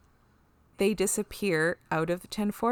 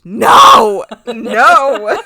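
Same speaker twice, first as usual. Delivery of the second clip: loud squealing no, no; screamed no; 4 semitones higher, 17 dB louder than usual. Retold or imitated. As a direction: scream it out in protest. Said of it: "And I just screamed".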